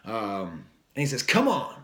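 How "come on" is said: quick rapper call, higher; imitating a rapper's shout. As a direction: bark it like a hype man. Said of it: "the rapper, just saying".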